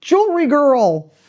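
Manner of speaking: a hammed-up biker greeting; bikers calling out. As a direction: call it out cheerfully across the street. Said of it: "they all like".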